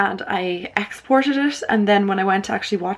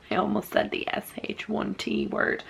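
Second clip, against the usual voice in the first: whispering; 2 semitones higher, 8 dB quieter than usual. Real, not acted. Embarrassed aside.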